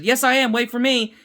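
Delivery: high-pitched mock call. Retelling Omega running after them, high-pitched calling out. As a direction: imitate a kid calling to be waited for.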